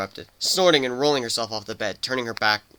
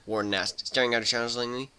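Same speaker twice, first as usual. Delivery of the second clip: ordinary delivery, nothing marked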